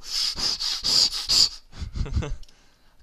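big airy burst of laughter; loud breathless laugh. Real, not acted. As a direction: crack up hard. Wild laugh mid-game.

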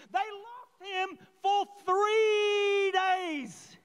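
high, slow, crying shout; anguished shout. Real, not acted. Preacher crying out to church over lost child, slow anguished shout. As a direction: wail it out in disbelief. Preacher contrasting three minutes.

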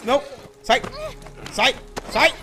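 sharp panicked yells; yelling. Real, not acted. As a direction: shout them away. Streamer yelling at attackers.

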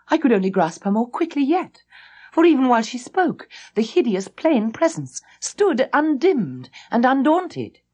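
ordinary delivery

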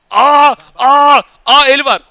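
sudden alarmed yelp; 1 surprised shout. Real, not acted. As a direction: catch the handball in alarm. Spots handball.

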